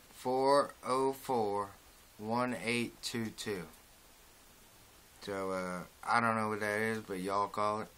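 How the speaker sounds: talking around snuff pinch. Real, not acted. Seen 2: Spits into cup before and after; lower lip looks slightly full throughout.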